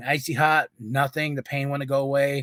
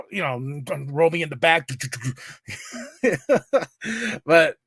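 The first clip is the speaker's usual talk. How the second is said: ordinary talk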